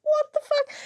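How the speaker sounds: exasperated what the fuck. Real, not acted. Venting to her co-host about the rules, incredulous outburst. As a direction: throw up your hands in disbelief.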